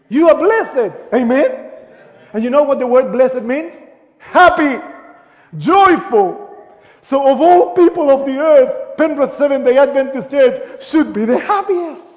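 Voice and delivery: loud, high, joyful preaching; fervent preaching. Acted. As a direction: lift the congregation up. Rising again into full preaching.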